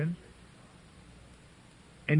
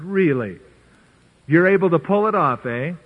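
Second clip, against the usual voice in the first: louder, mocking doubt; skeptical king; 2 semitones higher, 6 dB louder than usual. King scoffing at Daniel's claim, skeptical and loud. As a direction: scoff at the claim with arms folded. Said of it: "folded his arm and said".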